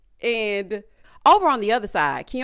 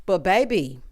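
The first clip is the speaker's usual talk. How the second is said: ordinary talk, nothing marked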